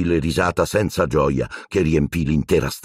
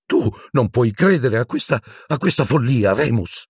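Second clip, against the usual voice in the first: high, frightened plea; pleading Pettigrew; 7 semitones higher than usual. A narrator's reading. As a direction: beg a friend to disbelieve. Pettigrew begs Lupin. (Pettigrew supplica Lupin con voce alta e spaventata)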